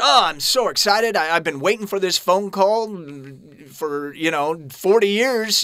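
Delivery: bubbling over with joy at a long-awaited call; excited. Retold or imitated. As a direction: retell his thrilled phone greeting. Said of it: "He's all excited".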